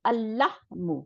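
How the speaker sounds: lam of আল merged into লাহমু; doubled lam. Shown as a demonstration. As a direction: hold one long doubled l in আল লাহমু. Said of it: "মিলে গিয়ে ওইটাকে সাদ্দা দিয়ে দেবে".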